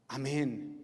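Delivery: ordinary delivery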